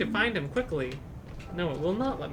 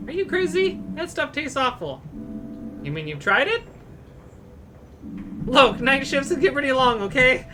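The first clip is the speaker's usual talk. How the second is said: lightly voiced patients and staff, raised pitch; voiced game characters; 6 semitones higher than usual. Streamer reading game dialogue aloud, lightly character-voiced. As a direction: read each hospital character in a higher put-on voice.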